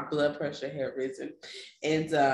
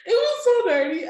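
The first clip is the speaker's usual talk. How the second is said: high and louder, amused; laughing; 7 dB louder than usual. Real, not acted. Telling a friend about her dirty clothes, laughing high.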